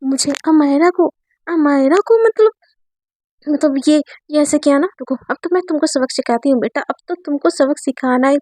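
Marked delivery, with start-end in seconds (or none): none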